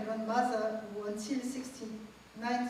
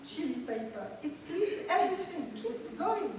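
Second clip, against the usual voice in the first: put-on higher, bright old grandmother urging, with a laugh; grandmother's voice; 4 semitones higher than usual. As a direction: play the insistent grandmother coaching her grandchild. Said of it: "She says to me".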